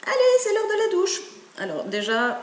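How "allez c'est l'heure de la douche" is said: put-on high, loud call to the kids; mother hen calling. Mother hen calls her chicks to the shower, high and loud. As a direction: sing out the bath call like a busy mother hen. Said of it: "c'est maman poule qui dit".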